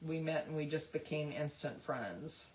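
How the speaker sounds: ordinary delivery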